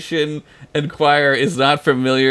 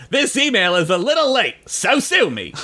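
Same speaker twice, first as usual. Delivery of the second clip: higher, cocky salesman; Scoops McGee voice; 5 semitones higher than usual. Acted. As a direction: pitch up and brush it off smugly. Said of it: "Keep going".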